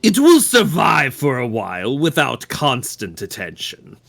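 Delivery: measured, solemn cult devotee voice; calm solemn devotee. Acted. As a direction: speak like a serene zealot. Player voices Witness character.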